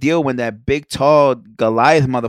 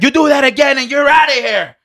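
much higher and louder, a barked warning; referee shout; 12 semitones higher, 6 dB louder than usual. Retold or imitated. Retelling the ref warning the player, loud mock shout. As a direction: shout it like a ref throwing someone out.

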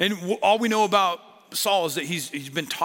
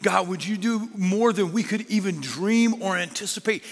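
fervent prayer; 3 semitones higher than usual. Acted. Prayer rising in force.

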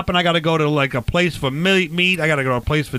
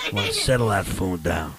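low character voice; 10 semitones lower, 4 dB quieter than usual. Acted. Host's low barbecue character voice.

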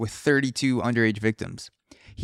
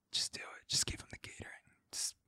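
urgent hushed whisper; 19 dB quieter, faster than usual. Pretend. Manager urging the cashier to comply, urgent whisper. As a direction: hiss it with no voice.

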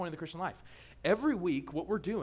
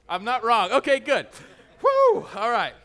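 loud laughing relief; 5 semitones higher, 13 dB louder than usual. Real, not acted. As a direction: laugh through it, catching breath. Laughing after crowd reaction.

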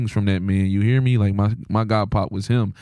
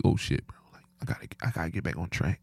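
muttering to self; 6 semitones lower, 10 dB quieter than usual. Retold or imitated. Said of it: "you like".

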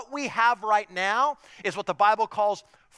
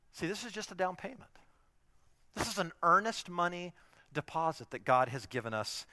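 ordinary talk

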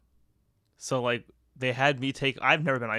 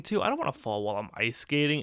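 ordinary talk, nothing marked

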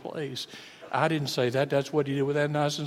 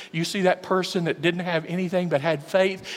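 usual speaking voice

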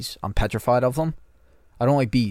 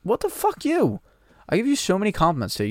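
sudden high-pitched indignant protest, speeding up; shocked and offended, push back fast; indignant outburst; 11 semitones higher than usual. Real, not acted. Friend tells him to kill himself, shocked high indignant outburst.

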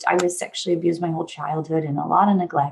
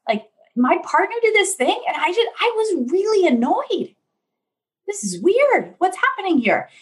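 much higher, bewildered voice of a client; puzzled client; 11 semitones higher than usual. Retold or imitated. Client telling therapist about new anger, puzzled. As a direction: sound surprised at your own anger. Client retelling newfound annoyance.

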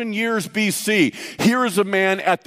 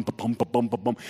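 much lower, much softer pounding heartbeat; 8 semitones lower, 7 dB quieter than usual. Retold or imitated. A scared cop walking up to a boxer, low hushed heartbeat thumps. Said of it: "he's about to throw down".

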